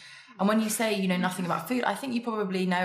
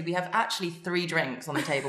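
ordinary talk, nothing marked

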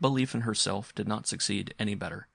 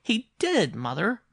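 put-on high, loud childish insistence; insistent boy; 12 semitones higher, 5 dB louder, slower than usual. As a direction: insist to Mother like a defiant child. Boy insists to his mother.

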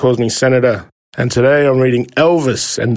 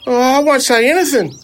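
put-on higher, eager young fella's voice; young Elvis; 11 semitones higher than usual. Lost bush kid asking the driver for a lift, eager. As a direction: ask it brightly like a hopeful bush kid. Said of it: "lied Elvis".

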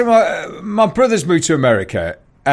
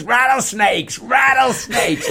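high, slow drawn-out announcement shout; announcing it; 7 semitones higher, slower than usual. Retold or imitated. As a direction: call it out like a public announcement. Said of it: "like she was announcing it".

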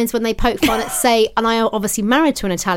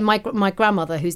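ordinary delivery

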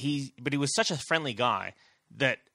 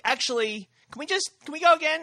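fussy client; 5 dB louder than usual. Retold or imitated. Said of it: "they'd be like".

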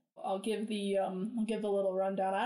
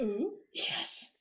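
whispered, delighted anticipation; excited hushed reaction; eager whisper; 3 semitones higher than usual. Real, not acted. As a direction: whisper it with relish. Eager reaction to superhero topic.

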